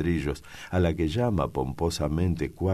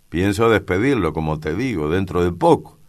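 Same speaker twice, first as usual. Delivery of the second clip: louder, emphatic insistence; insistent; 4 semitones higher, 7 dB louder than usual. A narrator's reading. Father returning to the topic of firing him, insistent. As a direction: press the point firmly. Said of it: "don Pancho insiste sobre el tema".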